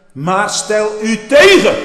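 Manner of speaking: slow, loud, high command; loud exhortation. Acted. Preacher urging the congregation to resist, slow loud cry. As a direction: drive it home slowly at full volume.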